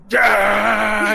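loud, high "yeah"; acted victory roar. Game master plays Hope facing her fans, loud roar. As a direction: bellow it to adoring fans. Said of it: "looks out at her new fan club, and says".

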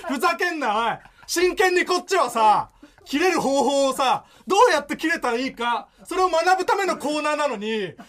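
aggrieved mock rant; exasperated comic tirade; performed anger. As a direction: protest indignantly, stretching phrase ends.

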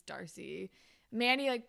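ordinary talk, nothing marked

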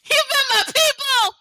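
high loud proud exclamation; excited shout. Real, not acted.

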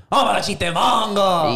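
hyped segment announcement. Real, not acted. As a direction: announce it like a game-show bit.

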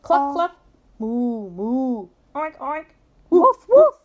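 high clucks building to loud woofs; yelled storybook animal sounds; animals yelling. A narrator's reading. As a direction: run through a chorus of farm animal noises. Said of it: "All the animals begin to yell".

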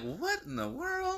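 slow, very high-pitched disbelief; imitated astonishment, stretched and high; mock bafflement. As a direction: draw it out in baffled wonder. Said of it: "you be like".

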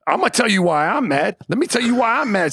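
normal talking voice

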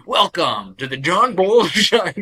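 loud, slow, high show-opener parody; hammed-up announcer welcome, breaking into a laugh; mock podcast intro. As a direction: stretch out a big mock welcome. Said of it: "your whole intro is just like".

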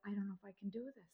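hushed, nearly voiceless confession; whispered doubt to husband before the wedding; whispered worry. Retold or imitated. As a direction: breathe it to your husband so nobody hears. Retold whisper to husband in bed.

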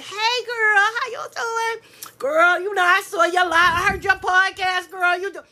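high saccharine two-faced fan; mock fake fan. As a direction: gush like a phony fan. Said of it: "those motherfuckers that be like".

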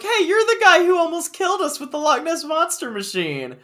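high, loud squeaky-boy imitation; Dipper. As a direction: call it out in a hammed-up high voice. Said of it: "Dipper was like".

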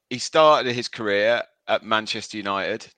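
normal talking voice

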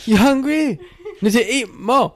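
very high, hammed-up Japanese granny; imitated Japanese grandma accent. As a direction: fuss loudly in a high mock Japanese accent. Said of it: "do your Japanese woman accent".

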